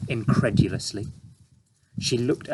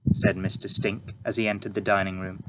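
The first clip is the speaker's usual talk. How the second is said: ordinary delivery, nothing marked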